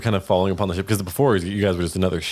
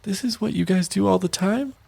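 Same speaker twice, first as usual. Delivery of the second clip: high, horrified question; 11 semitones higher than usual. Acted. Shinowis horrified at what her children do, high shocked voice. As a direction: recoil in shock. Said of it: "She's horrified".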